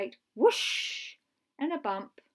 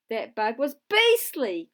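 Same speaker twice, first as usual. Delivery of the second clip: loud disgusted cry; cried out; 2 semitones higher, 8 dB louder than usual. Acted. Lucy crying out about the beastly bug. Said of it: "cried Lucy".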